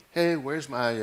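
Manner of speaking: usual speaking voice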